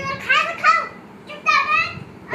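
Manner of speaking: loud, high scolding of pretend children; play bullying. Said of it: "कसे दमदाटी करते या".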